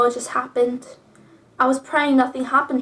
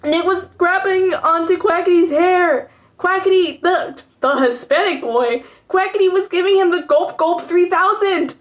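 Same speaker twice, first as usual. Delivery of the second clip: scandalized; 5 semitones higher, 4 dB louder than usual. Real, not acted. Reading a shocking fic aloud.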